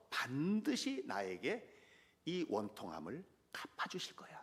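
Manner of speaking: soft breathy whisper of faith; hushed, confident whisper; whispered trust. Retold or imitated. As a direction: breathe it out as a private promise. David's quiet trust.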